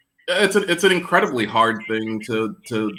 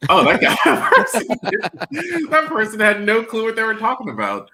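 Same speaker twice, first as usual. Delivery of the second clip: laughing; 13 semitones higher, 6 dB louder than usual. Retold or imitated. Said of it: "you're like".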